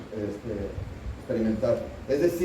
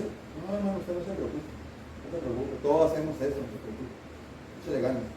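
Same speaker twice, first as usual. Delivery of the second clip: mock consoling; 7 dB quieter than usual. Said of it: "y luego dices".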